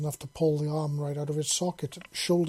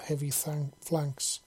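usual speaking voice

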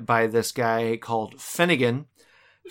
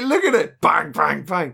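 mock excited; 3 semitones higher than usual. Voicing Sulu with gun.

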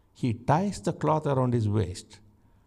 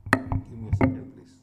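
whispered aside; faster than usual. Real, not acted. Aside off mic.

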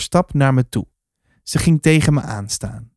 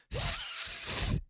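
voiceless puff of air; soft, breathy raspberry-like puff; little girl's puff; 17 dB quieter than usual. A narrator's reading. Little girl babbling on a lap, a soft puff. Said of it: "zei het meisje".

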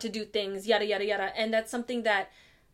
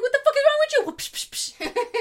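mock angry mom; 12 semitones higher, 4 dB louder than usual. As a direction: snap at the screaming kid. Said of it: "I'd be like".

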